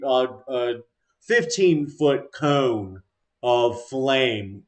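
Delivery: ordinary talk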